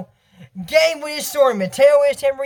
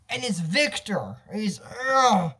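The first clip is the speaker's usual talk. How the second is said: usual speaking voice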